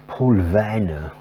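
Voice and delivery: a slow, hammed-up German accent on the name; a playful, put-on German accent on "Paul Vanna"; mock German accent. Shown as a demonstration. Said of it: "an attempt at a German accent".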